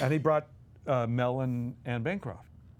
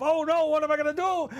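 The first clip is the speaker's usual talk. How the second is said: much higher, louder, hammed-up distress; mock cry of panic; 12 semitones higher, 5 dB louder than usual. Dom playing himself as a boy, hammed-up panic. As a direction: wail it like a frantic child. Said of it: "I cried".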